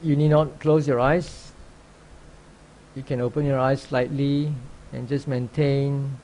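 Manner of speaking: ordinary delivery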